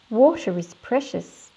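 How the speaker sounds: ordinary talk, nothing marked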